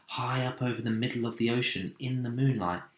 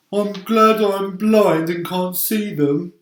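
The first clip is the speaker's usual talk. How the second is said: higher, louder and slow, fretful; a gloomy worm dreading the worst; nervous earthworm; 9 semitones higher, 9 dB louder than usual. Acted. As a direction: earthworm voice, anxious. Earthworm voiced in story.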